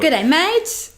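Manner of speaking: bright, high Aussie "Good day, mate"; Australian accent. Shown as a demonstration. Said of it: "Your Australian accent is better than mine".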